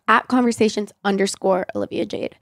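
usual speaking voice

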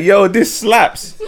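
drawn out, high, laughing praise; excited slow exclamation. Retold or imitated. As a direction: stretch it out in delight. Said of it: "I was like".

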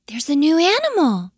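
high, excited storybook cry. A narrator's reading. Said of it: "She cried to Mister Morepork".

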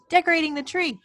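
high-pitched, lilting fond reminiscence; nostalgic Jon. Retold or imitated. Acting Jon reminiscing to Garfield.

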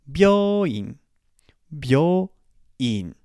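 byoin, BYO low then IN rising; low-high byoin. Shown as a demonstration. Teacher modelling hospital word byoin low then high. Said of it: "I can go low high".

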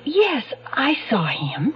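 barely voiced, slow admission; hushed whisper. Witness to detective, whispering a nervous admission. Witness reluctantly admits seeing him.

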